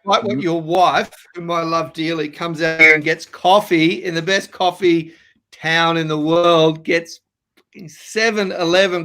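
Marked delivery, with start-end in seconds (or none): none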